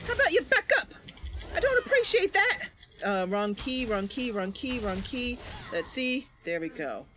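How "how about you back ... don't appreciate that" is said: much higher, louder prim rebuke; indignant character voice. Acted. Mercy scolding an enemy who crowds her, high indignant voice. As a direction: tell the enemy off haughtily.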